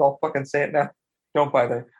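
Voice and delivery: ordinary talk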